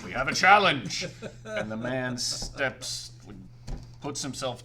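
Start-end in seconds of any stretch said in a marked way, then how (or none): 0.0-1.1 loud challenge to the room; calling out a challenge. Acted. Didgeridoo challenges the fat man, loud call. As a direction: shout it across the bar. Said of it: "he's like".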